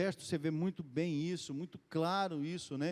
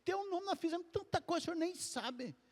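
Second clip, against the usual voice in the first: proud disciples; 11 semitones higher, 3 dB quieter than usual. Retold or imitated. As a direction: brag like proud disciples showing off their success. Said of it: "Chegaram até falando". (discípulos contando vantagem a Jesus, voz bem mais aguda)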